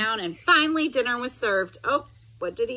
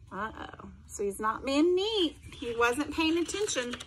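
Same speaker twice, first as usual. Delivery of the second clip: plain reading voice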